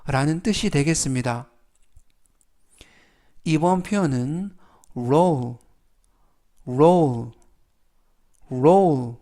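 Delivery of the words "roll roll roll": "roll" drawn out with a falling tune; teacher's drill of "roll", evenly repeated; pronunciation model. Shown as a demonstration. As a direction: model "roll" firmly as a separate word. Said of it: "이라고 발음하시면 되겠고요".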